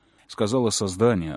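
usual speaking voice

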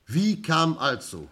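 a detective pressing the key point, slowly; emphatic question. Acted. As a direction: lean hard into the question. Inspector poses the crucial question.

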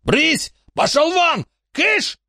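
very high, terrified shooing; panicked yelling. Acted. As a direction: scream at the giant dog. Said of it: "Бандит завопил".

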